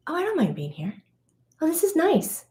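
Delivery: a high, delighted little voice; a hint of cooing delight; light pleased inner voice. Sensitive's inner voice quoted.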